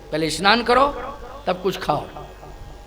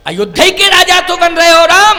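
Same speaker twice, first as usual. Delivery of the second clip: raised, loud exclamation to her son; insistent mother; 9 semitones higher, 9 dB louder than usual. Acted. Kaushalya asks Ram about his kingdom, insistent and loud. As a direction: push it out high and loud.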